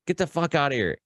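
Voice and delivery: high mock snap to leave; angry cop dismissal. Cop dismissing the teenage speeder, high mock angry order. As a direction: send the kid off like an angry cop. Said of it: "he just rips me a new one".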